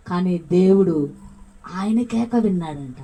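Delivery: ordinary delivery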